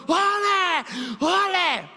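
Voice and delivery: high, loud drawn-out fan shout; grito de torcida. Retold or imitated. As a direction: yell it across the whole stadium. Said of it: "E eu gritando". (grito agudo de torcedor, bem alto)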